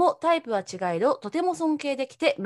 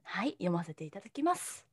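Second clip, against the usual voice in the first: whispering; 5 semitones lower, 11 dB quieter than usual. Real, not acted. Whispered reply before reading.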